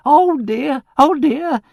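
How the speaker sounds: very high, loud lament; crying out. A narrator's reading. Charwoman bringing dreadful news, loud cry. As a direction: wail it out theatrically. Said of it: "cried Missus Ruddle".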